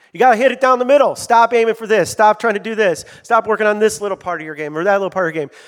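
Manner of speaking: rising to a loud self-lecture; self-coaching shout. Retold or imitated. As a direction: bark it like your own golf coach. Pastor voicing his own coaching self-talk.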